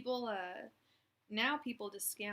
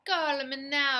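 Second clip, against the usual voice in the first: a much louder mock Jamaican psychic call; Miss Cleo Jamaican accent; 5 semitones higher, 13 dB louder, slower than usual. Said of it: "she had a fake Jamaican accent".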